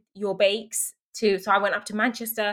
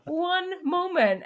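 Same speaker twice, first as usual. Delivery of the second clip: slow, high, laughing half-cry; play-whining plea, much higher and slowed right down; mock whine; 11 semitones higher, 4 dB louder, much slower than usual. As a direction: drag it out like a wounded child. Pleading with dad, mock hurt.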